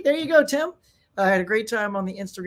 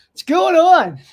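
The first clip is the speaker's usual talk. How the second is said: hype greeting; 8 semitones higher, 11 dB louder, slower than usual. Real, not acted. Greeting chat viewers.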